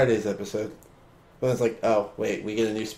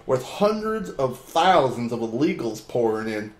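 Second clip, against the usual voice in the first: slow, emphatic read-aloud; dramatic reading; 3 semitones higher, 5 dB louder than usual. Acted. Reading an opinion piece aloud.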